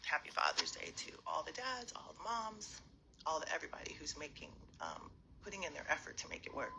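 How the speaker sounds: soft unvoiced whisper. Real, not acted. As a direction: hush the greetings. Whispers greetings as kids sleep.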